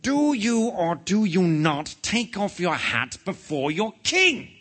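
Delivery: slow, high, outraged royal demand; imperious demand. A narrator's reading. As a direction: build to a loud peak on the title. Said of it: "demanded the king again".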